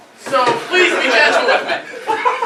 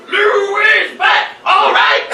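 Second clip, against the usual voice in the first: much higher, loud, slow shout; hype shout; 8 semitones higher, 6 dB louder, much slower than usual. Pretend. Friend cheering after the song, loud slow shout. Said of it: "he goes".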